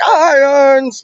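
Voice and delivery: high, drawn-out fan's call; going nuts. Acted. Fan spots a West Ham shirt, excited high call. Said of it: "I go nuts".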